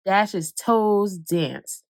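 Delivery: plain reading voice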